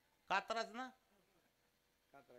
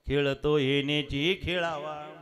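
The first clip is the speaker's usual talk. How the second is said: louder slower repeat; 6 semitones lower, 11 dB louder, slower than usual. Acted. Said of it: "गरुडाने सांगितलं".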